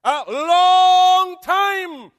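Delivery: A long time shouted, very slow and high; crying shout. Acted. Preacher crying out the key words to the church. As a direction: wail out A long time at full voice. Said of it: "That's the word there".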